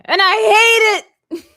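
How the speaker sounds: loud, high, drawn-out cartoon wail; mock outburst. Finishing the Squidward quote for the chat, hammed-up loud outburst. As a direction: belt it out as a hammed-up cartoon line.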